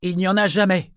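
high, loud snap back; angry retort. A narrator's reading. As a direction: throw it back at him forcefully. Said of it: "Elle le saisit par le col".

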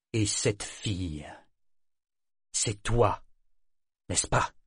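slow, hard accusation with pauses; hard, accusing. A narrator's reading. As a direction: corner them slowly, accusingly. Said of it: "d'un ton dur et accusateur". (d'un ton dur et accusateur)

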